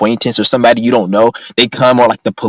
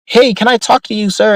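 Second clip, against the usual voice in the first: much higher, sing-song mock salesman pitch; 10 semitones higher than usual. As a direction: ham up a fake-friendly stranger making a pitch. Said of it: "Some stupid ass cracker coming up to me talking about".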